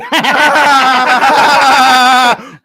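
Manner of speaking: loud high burst of laughter; big laugh. Acted. Friend bursts out laughing at the secret, loud high laugh. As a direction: crack up hard at the joke.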